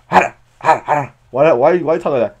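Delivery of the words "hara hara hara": short rough grunts mimicking a creature; imitated Pokemon grunt. Mimicking how the Pokemon talks, grunting. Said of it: "That's how he talks".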